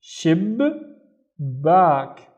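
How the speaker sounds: SHIB, pause, then BACK; syllable by syllable. Shown as a demonstration. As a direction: break it as "shib ... back", each syllable alone. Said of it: "pronounce it once with the previous syllable".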